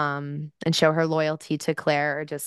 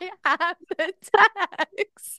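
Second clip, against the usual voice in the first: smug retort; 12 semitones higher, much slower than usual. Retold or imitated. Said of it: "And I'm like".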